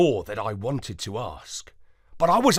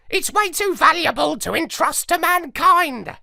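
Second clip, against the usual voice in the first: high, louder parrot voice, sneering; scornful parrot; 4 dB louder than usual. A narrator's reading. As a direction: warn him like a scornful old parrot. Parrot answers.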